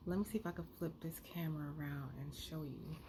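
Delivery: hushed fast whisper. Real, not acted. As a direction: whisper it quickly to the camera. Whispering aside to camera.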